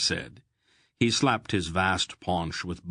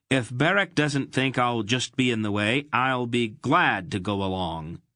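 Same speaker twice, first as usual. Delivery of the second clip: louder, higher defiant announcement; 6 semitones higher, 5 dB louder than usual. Acted. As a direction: declare it in defiance. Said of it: "he announced defiantly".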